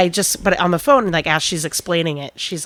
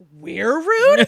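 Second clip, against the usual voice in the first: incredulous retort; 8 semitones higher than usual. Retold or imitated.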